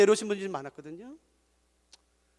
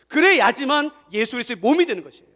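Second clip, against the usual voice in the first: ordinary delivery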